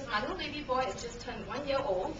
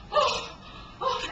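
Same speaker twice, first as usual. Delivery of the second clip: high, puffed-out gasps; acted breathlessness; 9 semitones higher than usual. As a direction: act winded from the uphill run. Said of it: "And I'm so breathless".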